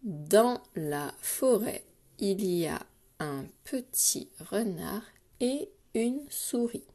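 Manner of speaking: slow dictation, broken into short groups; careful, spaced-out repeat for pupils writing. Shown as a demonstration. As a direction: repeat it slowly, pausing between word groups. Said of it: "Je répète".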